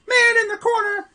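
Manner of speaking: very high, loud play-by-play cry; excited shout. Real, not acted. Commentator urges on the corner shot, high excited shout. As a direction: shoot the repeated call way up high. Repeats call as shot goes up.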